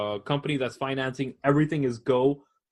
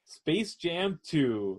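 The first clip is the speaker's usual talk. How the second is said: "Space Jam Two" drawn out and softer; slow, deliberate, softened restatement; slow repeat; 3 semitones higher, 5 dB quieter, much slower than usual. Real, not acted. Repeats it after "Wait, what?.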